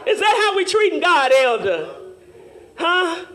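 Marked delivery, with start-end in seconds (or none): none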